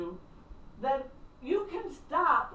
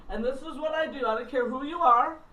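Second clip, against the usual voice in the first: ordinary talk, nothing marked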